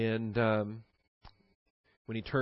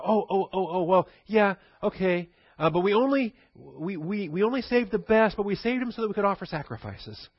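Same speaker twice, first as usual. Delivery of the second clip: high, loud, stammering cover-up; flustered excuse; 7 semitones higher, 4 dB louder than usual. Retold or imitated. Saul caught by Samuel, high flustered stammering excuse. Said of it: "he's already tried to cover".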